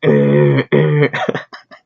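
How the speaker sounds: loud mimicked horn blasts; imitated truck horn. Showing how the fire truck sounds, imitated horn.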